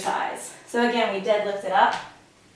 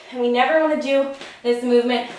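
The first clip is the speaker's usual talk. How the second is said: normal talking voice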